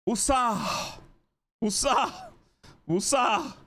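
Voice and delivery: high-pitched, forced calm-down chant; self-calming mantra. Real, not acted. Furious fan tries to calm down after bad call, strained mantra. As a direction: chant it to rein yourself in.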